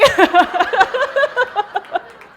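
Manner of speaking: loud, high burst of laughter; big laugh. Real, not acted. Laughing at what she said on air, loud laugh. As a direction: crack up at herself. Laughing at her own words.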